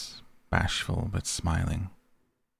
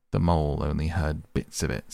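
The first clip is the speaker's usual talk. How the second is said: plain reading voice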